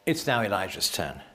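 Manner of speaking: ordinary talk, nothing marked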